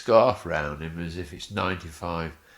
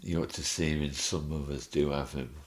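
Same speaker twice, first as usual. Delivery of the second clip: normal talking voice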